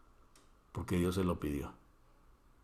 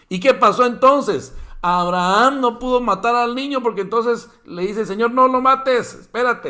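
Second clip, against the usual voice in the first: preaching at full force; 11 semitones higher, 11 dB louder than usual. Real, not acted. Preacher's climax, angel stops Abraham.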